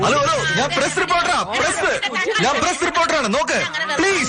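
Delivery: high-pitched, pleading insistence; urgent protest. Acted. Reporter protesting to police who grab him, urgent and high.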